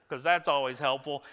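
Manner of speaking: ordinary delivery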